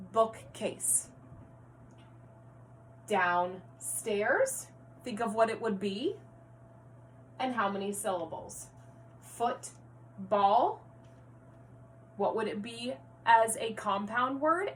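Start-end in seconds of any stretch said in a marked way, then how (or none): 3.1-4.7 "Downstairs" split as down, pause, stairs; segmented syllables. Shown as a demonstration. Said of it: "combining and segmenting syllables". 9.2-10.8 "Football" split as foot, pause, ball; segmented syllables. Shown as a demonstration. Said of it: "combining and segmenting syllables".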